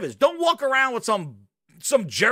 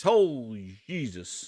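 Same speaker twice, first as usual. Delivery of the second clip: low, slow, disbelieving groan of a phrase; exasperated; 12 semitones lower, 6 dB quieter, slower than usual. Real, not acted. Reacting to the jank setup with slow disbelief. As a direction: let it sink in low and slow. Streamer mocks the trash setup.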